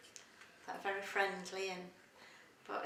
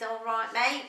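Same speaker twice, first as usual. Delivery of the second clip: Cockney accent; 2 semitones higher, 8 dB louder than usual. Shown as a demonstration. Interviewee showing how Cockneys talk, Cockney accent. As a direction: put on a matey Cockney call. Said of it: "and then the Cockney's".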